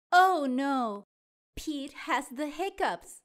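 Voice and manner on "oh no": plain reading voice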